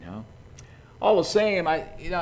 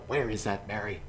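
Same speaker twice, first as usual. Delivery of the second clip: mumbling; 6 semitones lower, 7 dB quieter than usual. Acted. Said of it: "you can kinda hear her mumble".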